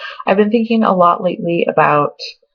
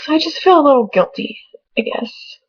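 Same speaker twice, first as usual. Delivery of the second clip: tearful; 8 semitones higher than usual. Real, not acted. Tearful over leaving family.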